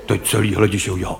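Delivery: ordinary talk, nothing marked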